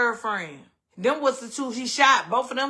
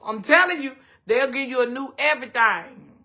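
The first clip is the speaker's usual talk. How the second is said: ordinary talk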